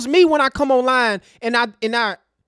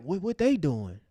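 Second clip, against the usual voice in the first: softer, lower mock snooping voice; mock nosy peeping; 7 semitones lower, 11 dB quieter than usual. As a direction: play the nosy outsider peeking in, hushed and low. Enemies peeping at their huddle.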